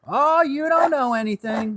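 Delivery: very high, loud country grandma; grandmother impression. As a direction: scold them like a high-voiced country grandma. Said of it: "That's the way she was".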